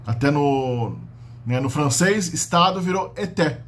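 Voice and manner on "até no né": ordinary talk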